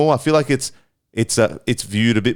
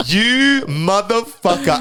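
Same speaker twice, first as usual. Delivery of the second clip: mock outrage; 8 semitones higher, slower than usual. Mock outrage at being called old.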